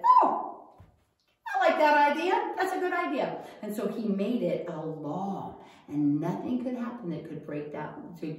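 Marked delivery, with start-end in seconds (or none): 0.0-3.4 high, delighted king voice; a much higher, loud delighted oh; pleased king. Acted.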